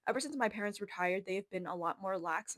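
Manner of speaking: ordinary delivery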